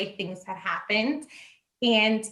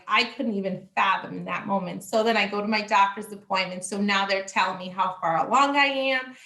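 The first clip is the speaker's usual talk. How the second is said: ordinary delivery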